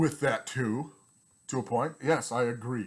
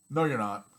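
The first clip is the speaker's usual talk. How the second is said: ordinary talk, nothing marked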